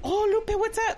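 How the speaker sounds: high, happy hello; excited greeting. Retold or imitated. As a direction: greet her like family. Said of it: "And he's like".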